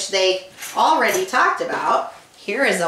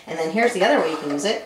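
ordinary delivery, nothing marked

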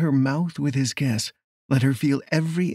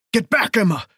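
high, urgent shouted order; shouted warning; 8 semitones higher, 4 dB louder than usual. Acted. Lawman warning his lover of a gun, shouting. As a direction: cry out to get her clear. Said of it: "Nathan shouted".

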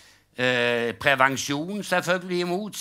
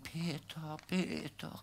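weak, much softer call to Peter; faint calling from the cross; 15 dB quieter, slower than usual. Retold or imitated. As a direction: call out feebly, dying. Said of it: "så roper Jesus igjen". (svakt, dempet rop fra korset)

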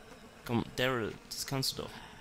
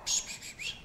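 short voiceless "psch" bursts; whispered run of shushes; shushing; 4 dB quieter than usual. Real, not acted. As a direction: shush in quick repeated puffs. Shushing noises while playing.

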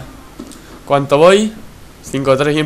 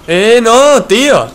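frustrated outburst; 7 semitones higher, 5 dB louder, much slower than usual. Real, not acted. Sudden outburst during gunfight.

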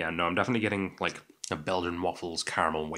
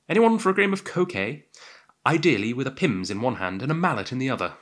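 hammed-up English accent, high opening call; put-on English accent; 5 semitones higher, 5 dB louder than usual. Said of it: "putting on an English accent there".

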